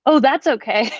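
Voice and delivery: mock-cheerful reply; oddly happy. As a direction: sound pleased that he can't do it. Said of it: "I said it like I was happy".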